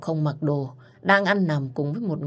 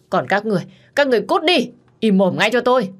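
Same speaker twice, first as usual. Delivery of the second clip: a rising, much higher roar at the crowd; furious shout; 6 semitones higher, 6 dB louder, faster than usual. A narrator's reading. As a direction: drive the crowd away, yelling. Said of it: "Ông Đăng lúc này gào thét". (người cha gào thét đuổi đám đông)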